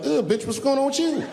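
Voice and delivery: mock-offended shriek; high startled shriek at the woman at the urinal; shocked high squeal. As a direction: squeal it high and outraged, half laughing. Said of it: "I'm gonna be like".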